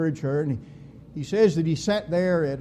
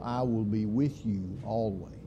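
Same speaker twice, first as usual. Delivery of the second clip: hushed promise; 7 semitones lower, 8 dB quieter than usual. Acted. Quoting Jesus, hushed.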